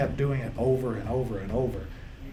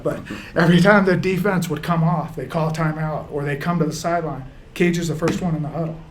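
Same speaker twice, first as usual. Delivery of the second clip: real choked-up voice pitched higher; 4 semitones higher, 6 dB louder than usual. Real, not acted. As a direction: fight back tears, pushing the words out high. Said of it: "I'm getting choked up".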